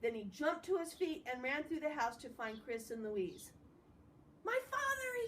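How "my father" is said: high, excited shout of joy. A narrator's reading. Salva shouting to Chris and Louise, overjoyed. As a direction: cry it out in joyful disbelief. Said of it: "he shouted".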